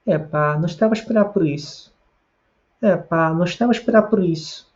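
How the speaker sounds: high Portugal-style melody falling at the end; European Portuguese accent. Shown as a demonstration. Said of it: "talvez com a entonação um pouco diferente". (entonação de Portugal, em tom agudo)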